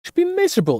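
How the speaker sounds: loud squeaky disbelief; stunned what. Real, not acted. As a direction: jolt up high in real shock. Shocked at villain's words.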